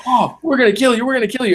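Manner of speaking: high, hammed-up threat; punched kid threatening the shop owner; mock threat. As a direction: yell it like a spoiled kid who just got punched. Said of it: "And the guy's like".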